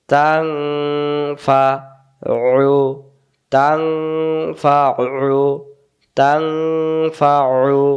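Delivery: তাং held in a long hum, then ফা and উ apart; hidden nun. Shown as a demonstration. Teacher building the word for students, long nasal তাং. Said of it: "গোপন করে পড়তে হয়".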